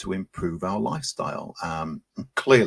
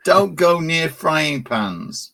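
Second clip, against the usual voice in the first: slow high cartoon cat voice; drawn-out public information cat; Charlie Says cartoon voice; 8 semitones higher, 6 dB louder, much slower than usual. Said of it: "Arthur says".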